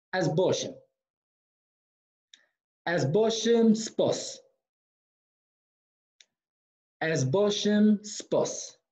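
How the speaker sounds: slow, evenly spaced "Ez Boshem Spos"; drawn-out Boshem, falling on Spos; model pronunciation. Shown as a demonstration. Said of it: "tout le monde à répéter après moi".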